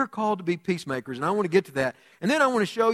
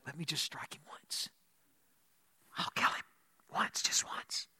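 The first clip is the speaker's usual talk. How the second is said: urgent, voiceless whisper; 12 dB quieter than usual. Acted. Abishai to David over the sleeping king, whispering. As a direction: plead under your breath, barely audible.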